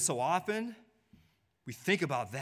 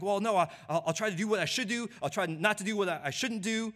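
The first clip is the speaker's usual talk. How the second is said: pleading defendant; 7 semitones higher than usual. Acted. Sinner promising to do better.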